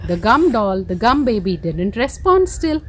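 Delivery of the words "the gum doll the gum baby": ordinary delivery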